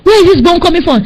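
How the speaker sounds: high-pitched startled cry; retold alarmed shout. Enemies under falling brimstone, alarmed shouting. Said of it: "they start to shout".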